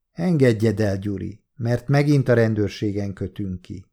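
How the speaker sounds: plain reading voice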